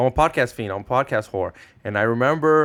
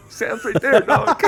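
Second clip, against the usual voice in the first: excited; 12 semitones higher, 6 dB louder, much slower than usual. Retold or imitated. Spotting Sam, urging George.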